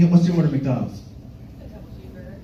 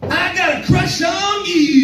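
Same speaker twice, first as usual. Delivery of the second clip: high, slow crush line; awkward high confession; 11 semitones higher, slower than usual. Retold or imitated. Comic confessing a crush to his date, high and slow. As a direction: squeak it out slowly, up high. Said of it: "I said".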